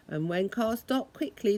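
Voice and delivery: ordinary delivery, nothing marked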